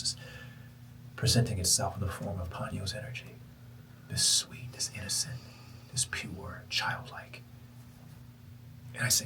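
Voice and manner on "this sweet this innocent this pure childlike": soft whispered wonder; hushed awe. Real, not acted. Describing the universe's childlike energy in an awed whisper. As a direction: breathe each word reverently.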